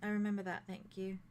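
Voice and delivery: soft, cool dismissal; quiet reply. Acted. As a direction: brush it off quietly. NPC answer, dropping quiet.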